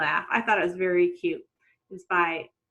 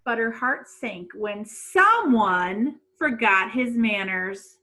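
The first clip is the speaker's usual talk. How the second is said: lively read-aloud storytelling voice; story narration; 2 semitones higher, slower than usual. A narrator's reading. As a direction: read it like a storybook to young kids.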